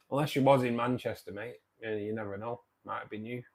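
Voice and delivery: normal talking voice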